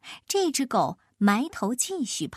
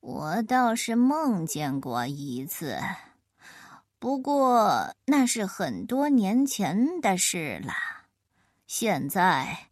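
put-on slow old turtle; 2 semitones lower, slower than usual. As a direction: stretch every word like a sleepy old tortoise. Narrator voices the slow turtle.

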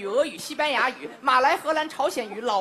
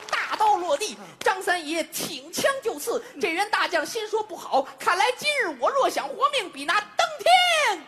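raised-pitch dramatic narration, climbing to the climax; Chinese storyteller; 5 semitones higher than usual. Shown as a demonstration. Storyteller voicing the doomed general's thoughts, high theatrical declamation. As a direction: stretch the climax high before the punchline. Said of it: "在中国用中文是这么说的".

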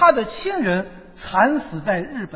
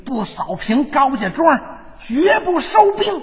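villain's battle vow; 3 semitones higher, slower than usual. Acted. Collaborator vowing beside the Japanese officer, slow rising bluster. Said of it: "抱着那个日本那个军官".

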